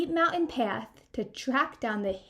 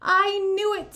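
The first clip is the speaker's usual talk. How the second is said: high, loud delighted cry; triumphant storybook whoop; 7 semitones higher, 7 dB louder than usual. A narrator's reading. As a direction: cheer it out. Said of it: "with a whoop of delight".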